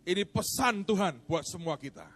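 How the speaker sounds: loud, high, forceful sermon delivery; fiery preaching. Acted. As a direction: preach it with full force to the congregation. Preacher at full force opening.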